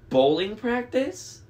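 high, slow questioning; puzzled mishearing. A narrator's reading. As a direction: ask it puzzled, voice climbing. Sleepy character mishears announcement.

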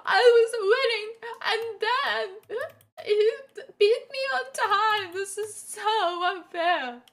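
put-on high, halting whine of a crying child; hammed-up tearful little kid; mock crying kid. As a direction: play a kid crying over a lost game. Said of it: "imagining a kid crying, being like".

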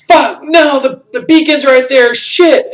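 high, loud panicked shout; panicked yell. Retold or imitated. Soldier screaming as the missiles fall, in a high panicked yell. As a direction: yell it as the strike comes in. Character sees missiles coming.

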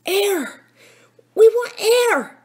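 high, loud cries for air; desperate gasp. A narrator's reading. Philip pretending to choke to fool Jake, desperate gasp. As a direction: cry out as if suffocating. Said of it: "gasped Philip".

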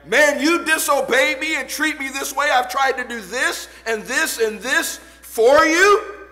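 high, loud guilt-tripping parent; scolding parent. Retold or imitated. Parent reproaching a disobedient child, loud. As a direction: lay the guilt on thick. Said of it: "I pull out that card".

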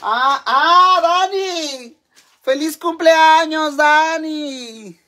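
high, loud, stretched-out cheer; celebratory holler. Real, not acted. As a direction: holler a birthday greeting with long vowels. Hollers a birthday greeting on air. (felicitación a gritos, alargada)

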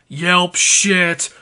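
normal talking voice

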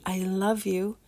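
usual speaking voice

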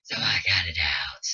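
very low, growling demon voice. Real, not acted. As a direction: growl it out from deep in the throat. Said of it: "don't judge my demon voice".

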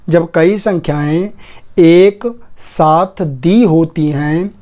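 very slow, word by word with gaps; slow dictation. Shown as a demonstration. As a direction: dictate it for students writing it down. Rule repeated slowly as dictation. (धीरे-धीरे, शब्द-शब्द रुककर लिखवाते हुए)

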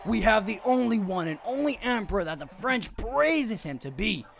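booming ring announcer intro; arena announcer. Acted. Streamer announcing fighters to the crowd, ring announcer. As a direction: call it out like a wrestling emcee.

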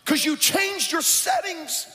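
high, driving preacher delivery; full-force preaching. Acted.

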